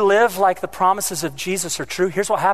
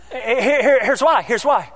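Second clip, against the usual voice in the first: usual speaking voice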